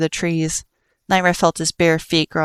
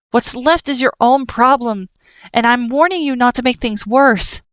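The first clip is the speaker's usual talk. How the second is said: firm shouted warning; 6 semitones higher than usual. Acted. Frisk warning Nightmare while dodging attacks, raised voice. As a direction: stand your ground and warn him.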